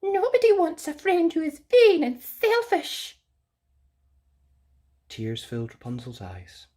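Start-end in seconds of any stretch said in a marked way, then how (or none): 0.0-3.2 very high, prim bird voice; Sylvia the bird. Acted. Haughty bird correcting Rapunzel, very high voice. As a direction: squeak it out like a haughty little bird. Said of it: "she replied".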